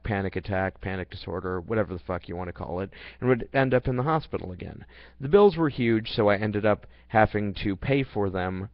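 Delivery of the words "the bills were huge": ordinary delivery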